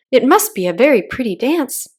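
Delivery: usual speaking voice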